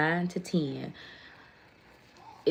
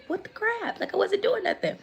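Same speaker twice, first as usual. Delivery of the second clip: disbelief. Retold or imitated. Reacting to being pulled over.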